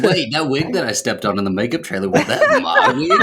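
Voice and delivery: teasing imitation that shoots up high, laughing; mock sudden realization. Co-host teasing friend who forgot wearing hair, mock sudden realization. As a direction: mimic a friend's dawning realization, climbing into a squeal.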